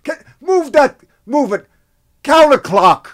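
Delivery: high, loud New York barking of orders; New York director. Retold or imitated. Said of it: "And he said".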